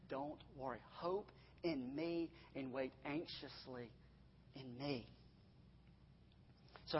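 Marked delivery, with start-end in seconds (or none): 0.1-5.1 very soft, low and slowing; tender reassurance. Acted. Preacher voicing God to the worried, tender reassurance. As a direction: soothe them quietly as God would.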